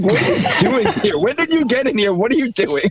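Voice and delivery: high-pitched questions through laughter; mock startled disbelief. Waking to find friend under the table, baffled.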